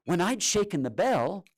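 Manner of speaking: ordinary delivery, nothing marked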